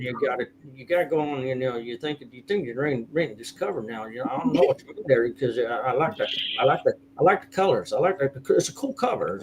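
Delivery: fast, run-together mumble; Boomhauer. Shown as a demonstration. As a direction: mumble quickly through it, words sliding into each other. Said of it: "the Boomhauer vo".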